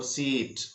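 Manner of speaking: सीट with a drawn-out ee; long ee seat. Shown as a demonstration. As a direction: hold the vowel in सीट. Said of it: "long sound होगा". (सीट में लंबी ई)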